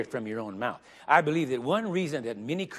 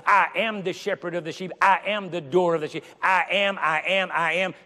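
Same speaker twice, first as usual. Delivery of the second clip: a preacher hammering home God's command; fervent preaching; 4 semitones higher, 4 dB louder than usual. Acted. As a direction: lift it up and drag out each word. Preaching, quoting Jesus in full voice.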